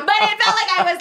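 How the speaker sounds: high, loud burst of real laughter; laughing. Real, not acted. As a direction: laugh your way into the words. Laughing at own joke.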